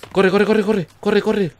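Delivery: panicked yelling while fleeing; high, loud, frantic run cry; being chased. Real, not acted. Fleeing a monster while playing.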